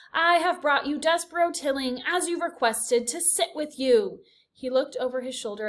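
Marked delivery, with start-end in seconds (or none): 0.1-4.2 loud, higher formal announcement; shout. A narrator's reading. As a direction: proclaim to the council. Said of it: "shouted Furlow".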